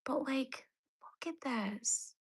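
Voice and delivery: unvoiced whisper, slow on look at this. Real, not acted. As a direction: breathe it out like a secret. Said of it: "whispered aside".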